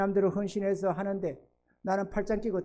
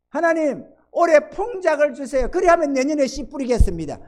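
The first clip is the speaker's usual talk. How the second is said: high, loud plea to God; insistent mock prayer; bargaining farmer; 11 semitones higher, 10 dB louder than usual. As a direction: act out a farmer demanding a good harvest first.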